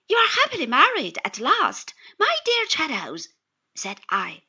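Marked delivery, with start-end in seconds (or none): 0.1-3.3 higher, louder congratulation to a friend; raised, joyful exclamation; delighted congratulation. A narrator's reading. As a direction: heartily congratulate an old friend. Narrator congratulates his newly married friend.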